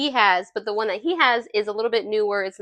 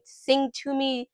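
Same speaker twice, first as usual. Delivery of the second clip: ordinary talk, nothing marked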